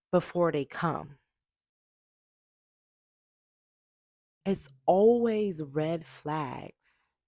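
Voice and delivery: loud emphatic. Real, not acted. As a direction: declare it loudly like a hard truth. Repeats the answer, now loudly.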